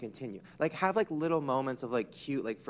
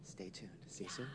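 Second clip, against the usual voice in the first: hushed teasing goodbye before the break; whispered sign-off; 8 semitones lower, 17 dB quieter than usual. Acted. Hosts whisper a teasing goodbye to viewers before the break, hushed.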